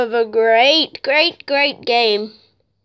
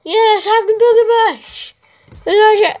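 excited protest; 5 semitones higher than usual. Real, not acted. Gamer fighting the vehicle picker, high excited protest. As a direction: squeal at the game, then cheer. Game picks the wrong vehicle.

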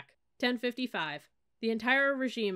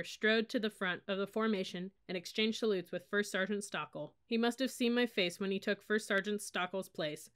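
ordinary delivery

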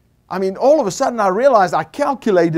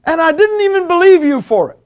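high loud outburst; blurted out shout; 11 semitones higher, 8 dB louder than usual. Retold or imitated. Stunned driver crying out to God, loud outburst. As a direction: let it burst out of you, much higher. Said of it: "this is what came out of my mouth".

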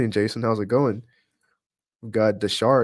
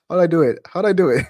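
higher, louder, laughing echo of a question; amused repeating; 7 semitones higher, 7 dB louder, much faster than usual. Real, not acted. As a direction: toss the question back, cracking up. Laughing at a funny chat question.

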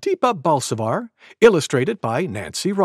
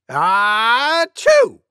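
high, loud, drawn-out mock sneeze; bigger pretend sneeze; 8 semitones higher, 5 dB louder than usual. A narrator's reading. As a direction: wind up, then burst into achoo. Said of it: "Maybe as loud as this".

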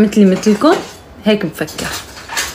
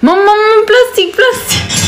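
urgent call; 12 semitones higher than usual. Real, not acted. Daughter yells to her mother for plastic as money falls, high urgent call. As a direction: yell up high for plastic, quickly. Money falling, calling mom.

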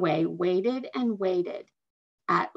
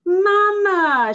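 high, loud excited cry; cried out; 10 semitones higher, 9 dB louder than usual. A narrator's reading. Little girl cries out to mother, high and loud. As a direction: call out to mother in delight. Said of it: "she cried".

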